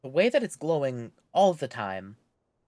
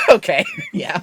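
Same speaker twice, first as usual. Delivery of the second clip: laughing out loud; 14 dB louder than usual. Real, not acted. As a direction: burst into high laughter. Laughing at friend's joke.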